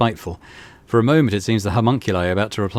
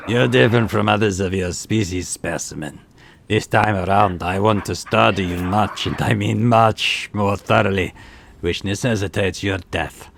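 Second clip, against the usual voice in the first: menacing arrogant xenos; slower than usual. Acted. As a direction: promise his death coldly. Tavantius threatens the specimen.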